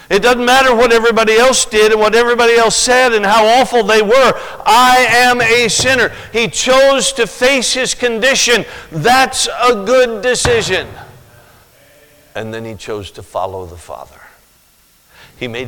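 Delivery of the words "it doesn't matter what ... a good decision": high, loud pulpit declaration; fiery preaching. Real, not acted. Preacher rebuking the congregation at full force. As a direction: preach it hard to the room.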